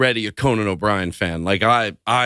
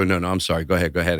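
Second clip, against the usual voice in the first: mock apology; 7 semitones lower, much faster than usual. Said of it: "you go".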